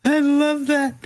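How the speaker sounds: high, gushing delight. Real, not acted. Game master reacting to a player's polite roleplay, real delight.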